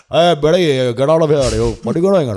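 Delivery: barking north Indian accent. Shown as a demonstration. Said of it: "So it's all kind of in the front".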